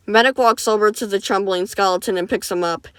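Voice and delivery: ordinary delivery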